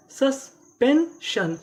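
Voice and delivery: slow, high, separated syllables of "suspension". Shown as a demonstration. Teacher answers own question for learners, slowly syllable by syllable. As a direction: sound out "suspension" loudly with clear gaps. Teacher sounds out the full word again.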